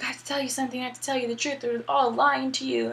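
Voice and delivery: lightly acted secretive woman on a call; weird caller. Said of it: "Naomi calls you and is all weird".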